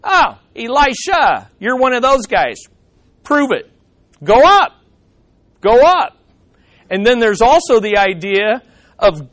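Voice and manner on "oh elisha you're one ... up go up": higher, louder sneering dare; preacher acting the mocking mob daring the prophet; taunting youths. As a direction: goad him to prove it. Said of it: "and they're saying".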